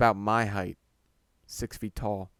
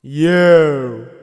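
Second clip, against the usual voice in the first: booming, drawn-out shout; single someone out, loud and long; frontman calling out; 4 semitones higher, 16 dB louder than usual. Acted. Lead guy pointing at the narrator, booming stage shout.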